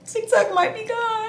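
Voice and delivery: high, whiny fake-crying pitch; hammed-up high whimper; mock wail. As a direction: squeal it like a dramatic fake cry. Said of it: "I'ma cry".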